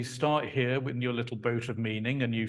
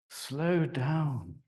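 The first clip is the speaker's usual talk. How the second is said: drawn-out slow down. Shown as a demonstration. Speaker demonstrating sound meaning, elongated. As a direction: stretch the words out, slow.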